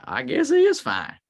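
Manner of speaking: acted incredulous reaction; baffled concession. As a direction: squeak it out as a stunned admission, playfully. Acting out his own baffled reaction.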